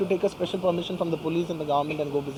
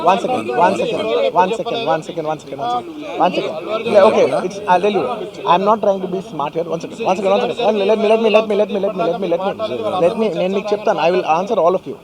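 loud, raised voice over noise; calling over a crowd; 7 dB louder than usual. Real, not acted. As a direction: keep repeating it loudly to settle the room. Calming down shouting reporters.

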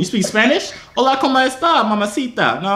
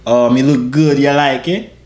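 Jamaican patois; 2 semitones lower than usual. Acted. Man fishes for compliments from a woman, Jamaican patois. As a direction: turn on the Jamaican version of yourself. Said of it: "the Jamaican version of me".

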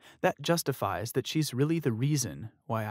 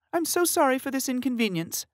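smooth it over politely; apologetic manager; 13 semitones higher than usual. Acted. Manager apologizing to the diners, polite.

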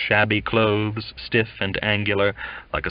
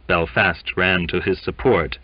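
plain reading voice

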